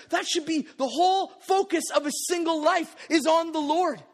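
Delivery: ordinary delivery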